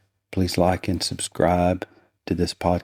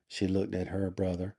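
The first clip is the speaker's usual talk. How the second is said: ordinary delivery, nothing marked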